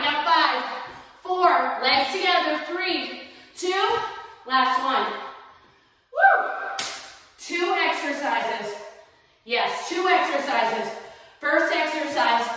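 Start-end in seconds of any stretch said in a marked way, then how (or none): 6.1-6.5 high cheering woo; excited whoop. Real, not acted. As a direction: whoop to celebrate the set. Cheering class at set end.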